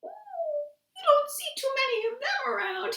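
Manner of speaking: frightened frog hiding his wide mouth; tiny puckered mouth. Acted. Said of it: "made his mouth as small as possible".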